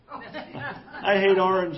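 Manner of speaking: drawn-out whine. Pretend. Second take, slow and loud.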